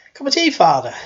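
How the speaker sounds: loud, high pestering housekeeper; Missus Doyle. Acted. Housekeeper offering the Father tea, loud pestering accent. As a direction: offer it loudly, swooping down from high. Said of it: "That was my best Welsh accent".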